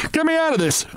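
high desperate bawl; panicked high cry for help. A narrator's reading. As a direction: yell to be freed from a nightmare. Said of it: "I bawled".

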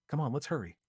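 a hint of brisk friend; Lucy voice.

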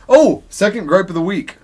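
ordinary talk

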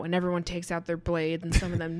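ordinary talk, nothing marked

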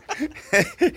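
high loud burst of laughter; real laugh at the punchline. Real, not acted. Laughing at own story.

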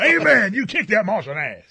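high, loud revival amen; hammed-up church call-back; mock congregant cheer. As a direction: egg the preacher on loudly. Co-host plays along as congregant.

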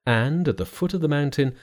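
ordinary delivery, nothing marked

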